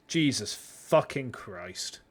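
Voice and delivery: ordinary talk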